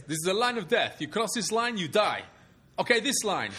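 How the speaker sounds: high-pitched comic dictator, laying down threats; Robin Williams. Retold or imitated. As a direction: imitate a comedian's blustering tyrant. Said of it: "There's a Robin Williams bit".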